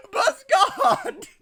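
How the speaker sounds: high, cracking up between words; real giddy laughter. Real, not acted. Reader adores the absurd bus god, helplessly laughing. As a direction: gush through helpless laughter.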